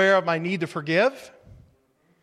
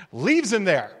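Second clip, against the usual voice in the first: usual speaking voice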